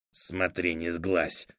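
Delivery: put-on grunting old voice. A narrator's reading. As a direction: grunt it while settling down. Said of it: "прокряхтел Фыф".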